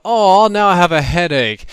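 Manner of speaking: loud, high, slow whine; mock complaint. Zombie shot through the head again, mock whining. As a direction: draw it out in pretend misery.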